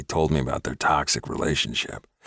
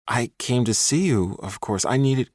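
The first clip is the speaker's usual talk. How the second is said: pleading ex; 6 semitones higher than usual. A narrator's reading. Ex boyfriend coaxing the woman he tracked down. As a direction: coax her gently.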